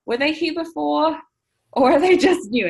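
high, surprised inner voice; puzzled surprise. Retold or imitated. Wondering whether the Jeeps were always there, surprised. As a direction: replay the baffled double take. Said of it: "And I'm just like".

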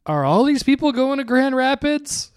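put-on high, loud puzzled old man; amazed first-time flyer; bewildered old man. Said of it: "he kept asking me".

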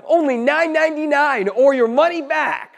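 loud, high pitchman sell; infomercial. Shown as a demonstration. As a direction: hype it like a TV salesman. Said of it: "like I'm a infomercial guy".

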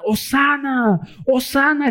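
high shouted hosanna; crowd's hosanna shout. Retold or imitated. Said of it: "Cuando ellos gritaban". (grito de hosana agudo)

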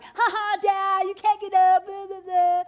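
high, loud, laughing taunt; kids taunting dad. Retold or imitated. Daughters trash-talking pinned-down dad, gloating high taunt. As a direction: imitate a gloating little kid. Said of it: "They're like".

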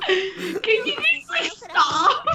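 laughing through the words. Real, not acted. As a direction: giggle as you speak. Laughing while speaking.

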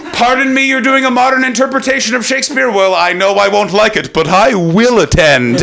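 high-pitched, mock-affronted theater snob; hammed-up theatergoer, pitched well up; snooty theatergoer. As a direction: play an indignant culture lover, drawing out the last words. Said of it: "I am a coffee sipping theater goer".

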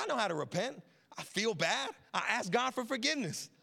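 smug. Retold or imitated. Mocking smug repenter.

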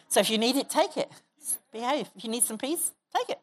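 usual speaking voice

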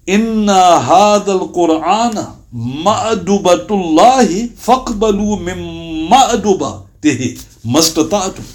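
measured devotional recitation; hadith recitation. Acted. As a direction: intone the Arabic like scripture.

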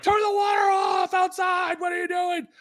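put-on high, frantic yell at the kid; retold yell. Dad yelling at his thirteen-year-old about the water, high and loud. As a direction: bellow at your teenager over the flood. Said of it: "I'm yelling at my thirteen-year-old".

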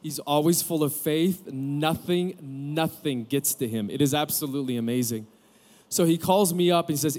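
ordinary talk, nothing marked

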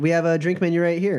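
bright high waitress voice; perky bartender pointing at menu; diner waitress. Acted.

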